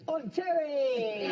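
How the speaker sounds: excited Welsh DJ shout; hammy Welsh club DJ; Welsh accent. Pretend. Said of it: "You keep doing that Welsh accent".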